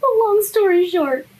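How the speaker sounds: high and loud summing up; excited. Real, not acted. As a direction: burst out to wrap it up. Excited summary.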